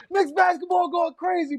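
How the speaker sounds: excited shout. Real, not acted. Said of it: "why you not getting excited with me".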